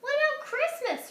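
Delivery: small brother protesting; brother calling. Acted. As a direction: call after her, dismayed. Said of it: "her brother called".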